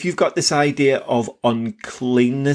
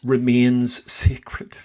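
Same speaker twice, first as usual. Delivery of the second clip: emotional. Real, not acted. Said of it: "I'm getting very emotional".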